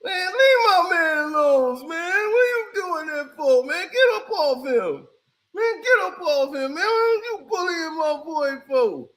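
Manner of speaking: put-on a dad wailing for his boy; upset father begging them to stop; crying dad. Dad voiced defending his son.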